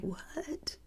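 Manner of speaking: stunned, whispered what; hushed disbelief, barely voiced. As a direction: breathe it out in mock shock. Her stunned reply to counselor.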